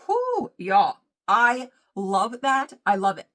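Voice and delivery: loud, drawn-out delight; excited. Real, not acted. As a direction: rave about it loudly. Raving about the dish.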